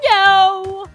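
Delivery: a mock yell for the game; short loud refusal; game's loud no. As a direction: voice the game yelling no. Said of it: "this game's like".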